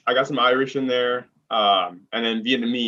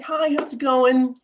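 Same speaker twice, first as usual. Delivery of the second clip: pitched-up helpful clerk voice; customer service voice; 11 semitones higher than usual. Retold or imitated. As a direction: welcome the customer with care. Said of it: "that care".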